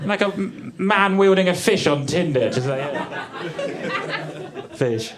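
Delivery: usual speaking voice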